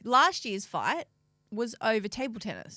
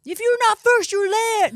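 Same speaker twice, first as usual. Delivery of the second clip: loud, high mock yelling parent; pushy sports parent; 12 semitones higher, 12 dB louder, slower than usual. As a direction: yell it like an overbearing coach parent. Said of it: "they were like".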